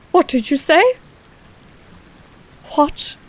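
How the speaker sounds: put-on light Cockney accent for a dwarf, a touch of outrage; a mild Cockney dwarf crying treachery. Dwarf Nar accuses the rangers, mild Cockney outrage. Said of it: "Cockney dwarf".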